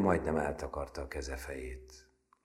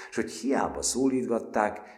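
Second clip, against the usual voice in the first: plain reading voice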